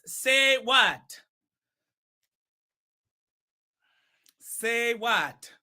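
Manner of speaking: emphatic put-on accent of Shaba. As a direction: announce it slowly in Shaba's accent. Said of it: "keep this accent all night".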